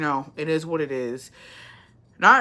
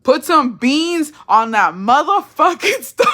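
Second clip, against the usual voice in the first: slow, high, loud yell breaking into laughter; exasperated demand; 9 semitones higher, 7 dB louder, slower than usual. Real, not acted. Exasperated plea about the cooking.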